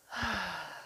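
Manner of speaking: long slow relaxing exhale; deep calming sigh. Shown as a demonstration. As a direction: breathe out slowly to calm the dog. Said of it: "I'm gonna take a deep breath".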